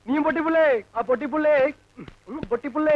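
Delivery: excited, very high crowing; high-pitched calling. Acted. Villager telling the old woman his new English word, crowing.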